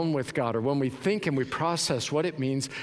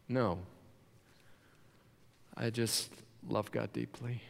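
tender soft confession; 4 semitones lower, 10 dB quieter than usual. Acted. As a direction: confess it gently from the heart.

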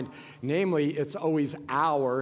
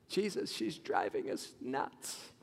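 low, hushed, breathy grumble; exasperated disciples; 4 semitones lower, 10 dB quieter, slower than usual. Retold or imitated. Disciples grumbling to Jesus, hushed and fed up. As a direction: mutter the complaint behind her back.

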